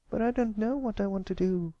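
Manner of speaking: put-on a young filly asking anxiously; young filly Applebloom. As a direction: sound like a worried young pony. Applebloom unsure.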